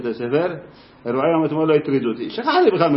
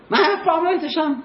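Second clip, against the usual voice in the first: amazed pupil; 14 semitones higher than usual. Retold or imitated.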